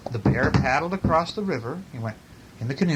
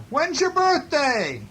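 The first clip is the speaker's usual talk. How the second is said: very high storybook bear voice; calling bear; 13 semitones higher, slower than usual. A narrator's reading. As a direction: call up to the moon in a high voice. Bear calls up to the moon.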